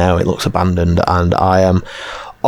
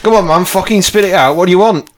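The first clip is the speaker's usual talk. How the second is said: impatient farmer in a higher-pitched character voice; surly English peasant; 10 semitones higher, faster than usual. Acted. Busy farmer telling a stammering stranger to spit it out, impatient. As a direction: play a put-upon countryman with no time for visitors.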